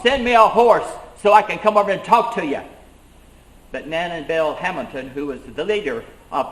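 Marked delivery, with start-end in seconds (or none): 0.0-2.6 loud, higher call across the water; mock shout over a river to the men. Fanning shouting across the river to the regulators, loud. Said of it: "and shouted across".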